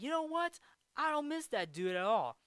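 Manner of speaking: high-pitched mock exasperation; annoyed retort. Golfer saying he doesn't miss the heckler, mock annoyed. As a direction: start high, retelling a fed-up comeback. Voicing Happy's annoyed reply.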